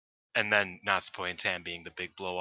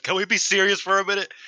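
higher, louder mock-serious impersonation; Lance Storm; 10 semitones higher, 7 dB louder than usual. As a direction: do a hammed-up wrestler demanding seriousness. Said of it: "did her best Lance Storm impersonation".

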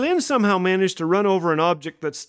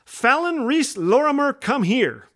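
high, chest-out proud recital; proudly; 6 semitones higher than usual. Retold or imitated. Little girl telling grandma her whole name, proud and high. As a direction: show off her full name. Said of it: "said proudly".

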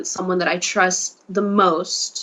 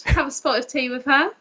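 higher-pitched put-on English accent; 6 semitones higher than usual. Shown as a demonstration. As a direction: play up the English tea-time accent. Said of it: "you had that accent".

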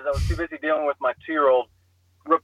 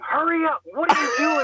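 mock screaming; 12 semitones higher than usual. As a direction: imitate a wife screaming at you to hurry. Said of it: "my wife is screaming".

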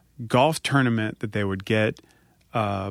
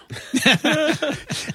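big high laugh. Real, not acted. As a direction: laugh out at the punchline. Laughing at his own story.

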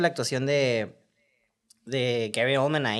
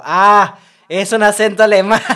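mock realization; 6 semitones higher, 13 dB louder, slower than usual. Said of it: "Y yo como".

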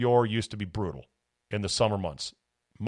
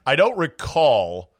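laughing through the words; 3 dB louder, slower than usual. Real, not acted. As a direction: chuckle as you answer. Laughing at the question.

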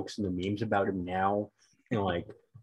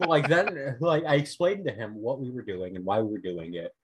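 ordinary talk, nothing marked

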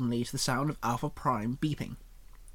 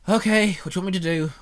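through his teeth; 4 semitones higher, 6 dB louder, faster than usual. Acted. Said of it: "said through his teeth".

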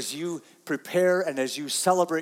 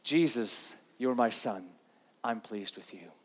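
tender fatherly voice; 8 semitones lower, 8 dB quieter than usual. Pretend. Tender paraphrase of God.

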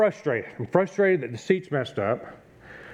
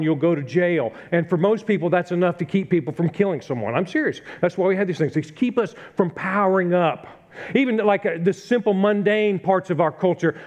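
preaching, building; 3 semitones higher than usual. Acted. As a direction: press the point to the congregation.

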